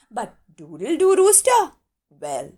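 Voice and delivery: ordinary delivery, nothing marked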